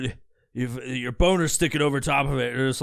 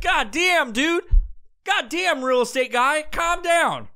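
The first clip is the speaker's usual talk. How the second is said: high-pitched, exasperated plea; taken aback; 13 semitones higher, 4 dB louder, slower than usual. Acted. Startled listener telling real estate guy to calm down, high and exasperated. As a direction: react as if overwhelmed by an aggressor.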